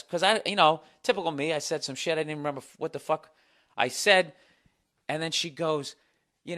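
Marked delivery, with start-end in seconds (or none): none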